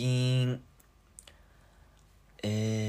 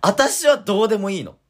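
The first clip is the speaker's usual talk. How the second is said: high, loud wounded complaint; jealous wife; 12 semitones higher, 10 dB louder than usual. Pretend. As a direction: cry out like a hurt partner. Mock jealous partner quarrel. (傷ついた妻のように高く大声で)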